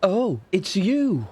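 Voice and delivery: high, drawn-out "oh"; displeased recognition. Acted. Fortune-teller spots the man she cheated, displeased recognition.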